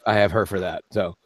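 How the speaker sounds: ordinary talk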